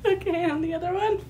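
ordinary talk, nothing marked

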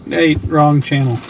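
ordinary talk

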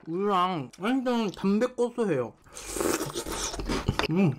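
chewing while talking; talking between spoonfuls; mouth full. Real, not acted. Seen 0: Spoonfuls of cereal taken to mouth while talking; lips show chewing.

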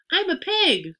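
put-on high, loud boyish shout; gleeful cry. A narrator's reading. Boy answers his scolding mother, gleeful cry. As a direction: crow it high and loud, playing pig. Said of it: "cried Avery".

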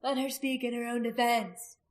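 pleading. A narrator's reading. Woman asking the crowd for justice, pleading. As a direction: plead for a fair hearing. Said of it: "she pleaded".

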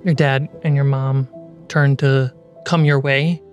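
ordinary talk

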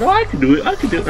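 high-pitched imitation of an eager kid; mock little-brother voice, pitched well up. Kid brother begging a turn at the wheel, eager imitation. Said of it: "And then he says".

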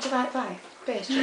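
quiet, understated mum impression; imitating mum. Retelling Aisha's story of her mum, hushed imitation. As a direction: softly mimic someone's mother. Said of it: "The way that Aisha told it".

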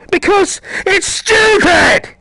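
sudden high, loud angry yell; exasperated shout. Real, not acted. Gamer stuck searching inside the lab, exasperated yell. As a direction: break into a drawn-out frustrated scream.

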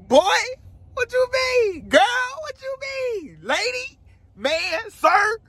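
silly falsetto bits, one cries out; squeaky falsetto. Pretend. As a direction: squeal each question in falsetto. Playful squeaky voices.

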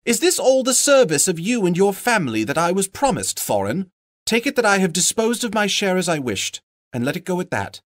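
high, quick reproachful voice; timid hobbit. Acted. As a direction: reproach him, nervous but firm.

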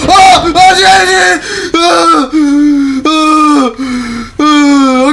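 repeated loud, high-pitched screams; panicked screams. Real, not acted. Gamer scared by a sudden threat, screaming in panic. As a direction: scream in bursts at a jump scare.

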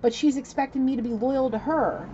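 high, loud, aggrieved venting; loud, worked-up griping; heated complaint. Retold or imitated. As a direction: vent about her at high pitch and full volume. Channels man's complaint about woman.

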